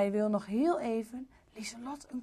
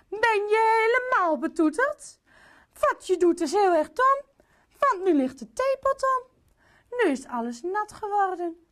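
high, loud telling-off; indignant queen scolding her husband, high and loud; 7 semitones higher, 4 dB louder than usual. Acted. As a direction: scold him like a cross mother. Queen scolds king for mess.

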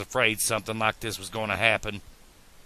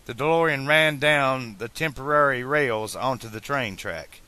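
ordinary delivery

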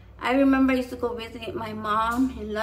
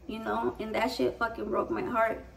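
normal talking voice

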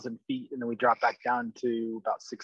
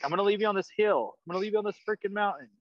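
higher, fast, half-joking exasperated threat; mock impatient threat; 8 semitones higher, much faster than usual. Old impatient dad threatening his scared daughter on the hill, mock exasperated. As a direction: rattle it off like a fed-up dad losing it. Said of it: "Or, like".

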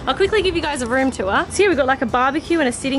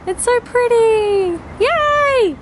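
high and slow, full of delight; delighted squeal; 9 semitones higher, 3 dB louder, much slower than usual. Real, not acted. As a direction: gush over the sunset. Delighted by the sunset dinner.

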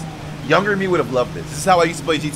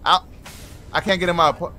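usual speaking voice

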